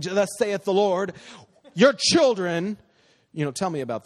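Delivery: overdone holy announcement, tongue in cheek; hammed-up super spiritual prophet voice; mock prophetic pronouncement. Shown as a demonstration. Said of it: "I didn't make it super spiritual".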